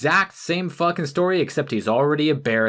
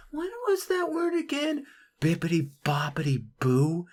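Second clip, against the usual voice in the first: high, soft airhead fairy voice; a mocking, breathy scatterbrain voice; mocking ditzy fairy; 4 semitones higher, 7 dB quieter than usual. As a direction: play a forgetful fairy, airy and lost.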